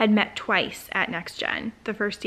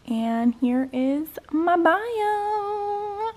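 high, slow, drawn-out reveal; giddy excitement; 8 semitones higher, much slower than usual. Real, not acted. Vlogger presenting her bio on screen, giddy. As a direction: stretch it out high on the last word.

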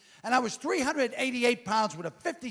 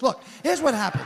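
usual speaking voice

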